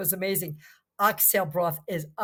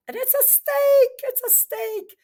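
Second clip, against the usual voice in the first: excited; 7 dB louder, slower than usual. Real, not acted. Excited about her find.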